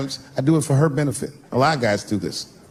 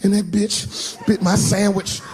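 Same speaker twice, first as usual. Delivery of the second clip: put-on slow, higher boy voice; whiny kid; 8 semitones higher, slower than usual. Son telling dad who bit his sandwich, slow kid whine. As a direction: drag it out like an aggrieved kid. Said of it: "He said".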